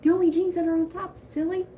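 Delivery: high, quick teasing correction. Retold or imitated. Telling her husband which jeans, high teasing. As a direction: tease him like it's obvious. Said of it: "I was like".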